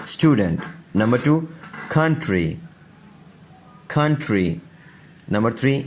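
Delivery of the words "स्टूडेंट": स्टूडेंट with a clean st onset; s runs straight into t in स्टूडेंट; vowelless initial s. Shown as a demonstration. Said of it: "कोई ज़बर ज़ेर पेश नहीं".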